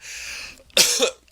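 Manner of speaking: loud hacking cough; just swallowed a bug. Real, not acted. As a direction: cough something out of the throat. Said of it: "I think I swallowed a bug".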